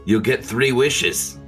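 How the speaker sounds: ordinary talk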